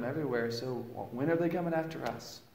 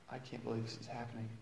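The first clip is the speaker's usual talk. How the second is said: much softer, quick, stunned murmur; soft, fast, shaken aside; hushed disbelief; 6 semitones lower, 15 dB quieter, faster than usual. Retold or imitated. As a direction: say it under your breath, reeling. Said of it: "And I was like".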